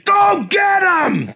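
huge, high, drawn-out shouted command; mob boss's order. Retold or imitated. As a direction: cut in yelling an order to your men. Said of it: "the boss says".

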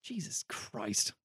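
soft, slow, weary "Jesus Christ"; exasperated mutter. Retold or imitated. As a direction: sigh it out under your breath. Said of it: "I'm like".

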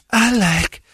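high giddy mock-thrilled voice, rising to a shout; giddy excited voice. Mocking the daters on the show, puppyish high excitement. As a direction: squeal it like an overjoyed puppy.

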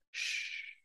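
soft, hushed shh; quiet drawn breath of a shush; soothing shush. A narrator's reading. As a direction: gently shush someone upset. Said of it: "she says".

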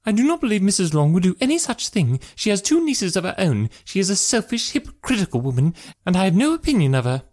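high-pitched, indignant mother voice; put-on high voice, running someone down.

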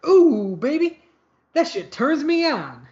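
high, slow mock-seductive purr; sleazy come-on. Guy calls after her as she runs, mock-seductive. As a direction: ham up the creepy flirt. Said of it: "He says".

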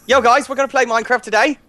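high-pitched British YouTuber greeting; British accent. Acted. Greeting YouTube subscribers in a mock British accent. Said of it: "if you have a British accent".

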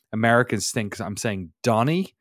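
usual speaking voice

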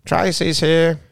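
high put-on old lady voice; mock bingo lady. Mates imagining Tracy at bingo, put-on old lady voice.

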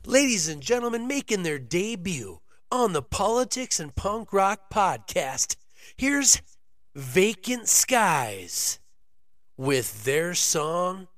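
high, slow stage announcer. Acted. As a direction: introduce the band like an MC. Introduces the band like an MC.

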